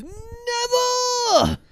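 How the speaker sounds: defiant shout. Retold or imitated. Harry refusing Voldemort, hammed-up defiant yell. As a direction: scream it in mock defiance. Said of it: "And then Harry goes".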